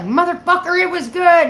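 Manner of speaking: high, loud mock outburst; riled-up dad. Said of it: "get him all riled up".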